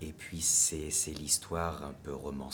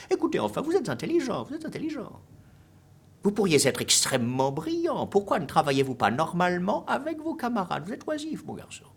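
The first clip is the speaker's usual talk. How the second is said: loud, high-pitched pleading reproach; Monsieur Valu, vexed; 13 dB louder than usual. A narrator's reading. Teacher scolds an idle but intelligent boy, vexed and very high. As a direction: lecture the pupil in an exasperated, soaring voice. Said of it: "la voix vexée de Monsieur Valu".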